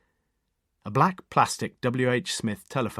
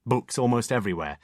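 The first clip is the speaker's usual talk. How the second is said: ordinary delivery, nothing marked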